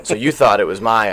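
normal talking voice

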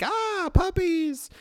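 put-on slow, high little kid voice. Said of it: "is like".

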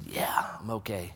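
whispered, barely voiced; dazed whisper. Face down on the slope answering the stranger, dazed whisper. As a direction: breathe it out half knocked out. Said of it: "dazed reply whispered".

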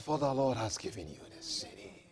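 soft quick assurance; hushed follow-up. Real, not acted. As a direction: drop right down after the shout. Softer after shout.

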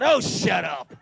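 slow, loud and scornful; dismissive God. Retold or imitated. God curtly dismissing the whining pastor, scornful. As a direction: brush him off with contempt. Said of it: "Don't bring that spirit into the church".

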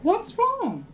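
high, sugary coaxing wife; a sweet high wife's voice asking her pouting husband what's wrong; Jezebel coaxing. Retold or imitated. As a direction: coo it up high like a doting wife. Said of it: "said to him".

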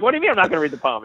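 high, rapid mock disbelief; incredulous father. A father refused a look at his daughter's poem, mock incredulous. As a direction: imitate a dad taken aback, fast and high.

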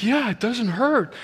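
high-pitched, delighted insistence, retold; amazed confirmation. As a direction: imitate an astonished man confirming it. Healed man confirms, acted out.

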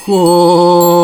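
slow, drawn-out snail voice. Acted. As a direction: stretch the word lazily like a snail. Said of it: "медленно и протяжно".

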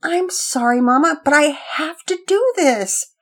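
put-on high, slow pleading boy; desperate. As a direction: beg with a high voice. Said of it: "Pearson was desperate".

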